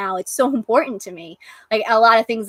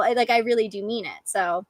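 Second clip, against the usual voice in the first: ordinary delivery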